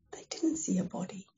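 whispered, hushed reveal; suspense whisper. Acted. Storyteller whispers to the congregation, hushed. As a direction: whisper it like a secret.

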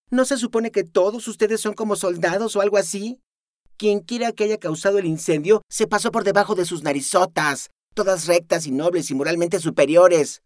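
high, loud sarcastic jab; mock their nobility; mocking scorn. Luzma mocking the squad, scornful.

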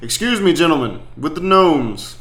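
calling out. Acted. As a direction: call over to the gentlemen with the gnomes. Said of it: "he's gonna call out".

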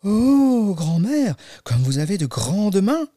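high, swooping amazed "oh"; drawn-out storybook astonishment; exclaiming little girl. Acted. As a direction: marvel like a wide-eyed child. Girl marvels at disguised wolf.

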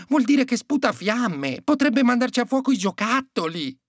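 high-pitched elf voice; fretting elf. A narrator's reading. Elf objecting that the dragon could burn the toys, high and fretting.

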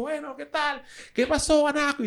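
high-pitched mimicry of the guy; voz imitada del tipo. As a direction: imitate his greeting way up high. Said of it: "el tipo ahí". (imitando al tipo con voz aguda)

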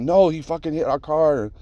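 half-acted angry comeback at the wife; pissed-off retort. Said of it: "I'm all pissed".

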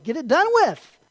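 high, loud, impatient push; impatient urging. Acted. Preacher voices impatient onlookers asking why Jesus stops to cry, impatient. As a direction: urge it on with exasperated force.

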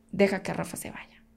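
breathy unvoiced whisper, pleading; begging the universe to spare her son, whispered; whispered prayer. Silent prayer while being choked.

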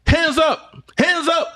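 high, barked police command; police shouting. Retold or imitated. Cop barking at him to raise his hands, high mock shout. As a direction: bark it like a cop with a gun drawn.

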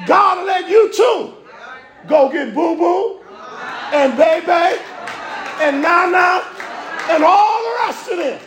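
folksy shout to the congregation; preaching at full force. Acted. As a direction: call out the names with a grin. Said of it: "let me say it right".

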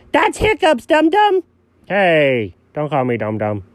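ordinary talk, nothing marked

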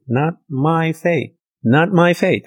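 emphatic not MY faith; stress on my. Acted. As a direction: lift the word "my" up high. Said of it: "your faith".

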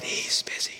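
put-on a child's small hushed whisper; soft whispered hello on the phone. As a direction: breathe it out like a hiding four-year-old. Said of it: "And Jimmy just responded".

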